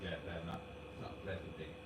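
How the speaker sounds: hushed off-stream murmur; quiet. Real, not acted. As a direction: keep it low, they can't hear. Muttering so viewers can't hear.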